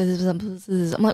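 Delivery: normal talking voice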